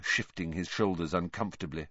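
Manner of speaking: usual speaking voice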